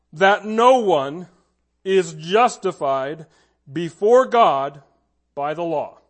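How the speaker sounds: slow, weighty, each phrase set apart; said slowly. Shown as a demonstration. Preacher reading the verse to his congregation, slow and emphatic. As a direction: let that no one is justified before God land slowly. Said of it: "I said that slowly".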